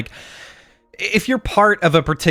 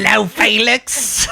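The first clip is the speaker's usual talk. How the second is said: high, slow, hammed-up Cockney hello; exaggerated Cockney; 6 semitones higher, slower than usual. Teasing the British editor with hellos, exaggerated Cockney. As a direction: call hello in a silly Cockney. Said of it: "It's exaggerated Cockney".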